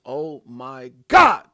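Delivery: slow build to a shouted last word; drawn-out exclamation bursting loud; beyond excited. Real, not acted. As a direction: stretch it out, then explode on the end. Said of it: "I'm so excited".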